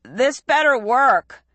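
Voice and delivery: nervous cry. A narrator's reading. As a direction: brace for the jump. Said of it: "Hero cried".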